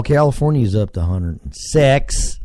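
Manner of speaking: ordinary delivery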